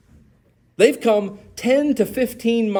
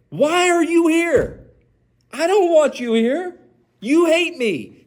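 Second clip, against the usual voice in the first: much higher, slow and pleading in disbelief; exasperated; 10 semitones higher than usual. Retold or imitated. Isaac confronting the king who drove him off, high and exasperated. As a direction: pitch it up high, stretched out and fed up. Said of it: "That's what he asks to the king".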